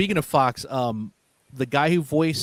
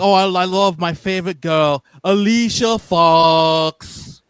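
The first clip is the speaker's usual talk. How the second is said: exaggerated Welsh accent, louder, name drawn way out; mock Welsh accent; 3 semitones higher, 7 dB louder, slower than usual. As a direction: imitate a Welsh wrestler stretching out the name. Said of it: "guy from Wales in the U K".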